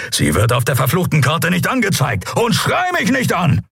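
anger rising to a high shout; fury breaking out. Acted. Pilot telling Endriel not to yell, fury rising to shout. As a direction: build from held rage to yelling. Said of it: "mit kaum unterdrückter Wut".

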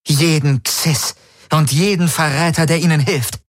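high, cold command of a ruthless ruler; ruthless queen. Acted. Ylana ordering her forces to destroy every traitor, high cold voice. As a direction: order total destruction without mercy.

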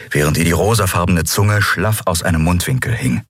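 ordinary delivery, nothing marked

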